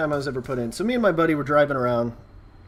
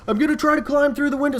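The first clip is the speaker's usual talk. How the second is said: higher, raised voice; friend explaining; 11 semitones higher, 3 dB louder, faster than usual. Retold or imitated. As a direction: call out over the noise. Friend answering cop.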